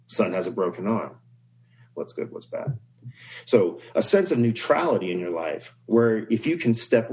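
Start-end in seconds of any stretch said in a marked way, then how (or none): 1.9-2.8 "What's good, what's bad?" breathed softly, unvoiced; quiet refrain. Shown as a demonstration. Storyteller closing the farmer parable, whispered softly. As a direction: let the refrain fall away to a whisper. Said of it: "repeated refrain as moral".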